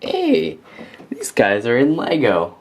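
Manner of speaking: high-pitched "Hey", then easing down; excited mock double-take; delighted recognition. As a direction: re-enact spotting something you know with pleased surprise. Acts out his excited recognition.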